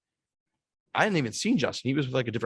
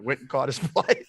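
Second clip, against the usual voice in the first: usual speaking voice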